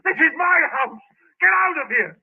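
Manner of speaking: high, loud order; angry. Acted. Tenant ordering the policeman out, angry. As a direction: defend his house.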